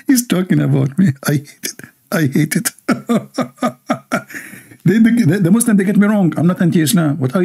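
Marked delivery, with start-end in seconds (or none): 2.8-4.4 quiet high-pitched chuckle; laughing quietly at the comment; soft high laugh. Real, not acted.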